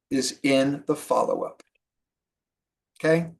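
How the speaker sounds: ordinary delivery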